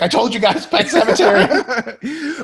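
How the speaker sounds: loud, high mock panic; freaking out. Imitating the Flash as Superman comes back to life, freaking out. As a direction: shout it in hammed-up alarm, half laughing. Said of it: "starts freaking out".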